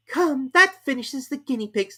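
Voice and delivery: high, slow, pleased; high slow Alice; Alice musing. A narrator's reading. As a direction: think it with relief. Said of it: "thought Alice".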